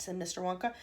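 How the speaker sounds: ordinary delivery, nothing marked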